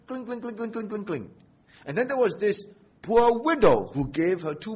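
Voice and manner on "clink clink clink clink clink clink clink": high, soft, rapid-fire clinking; imitated coin clinking. Preacher imitating a rich man's offering coins, high tinkling. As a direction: mimic coins dropping in a light, high patter. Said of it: "made a lot of noise with his coins".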